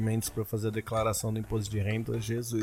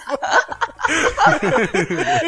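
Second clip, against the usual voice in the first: loud laugh; 7 dB louder than usual. Real, not acted. Laughing at the reply.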